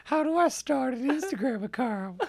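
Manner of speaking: hammed-up creepy cartoon old-timer; Old Man from Family Guy. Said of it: "Old Man from Family Guy".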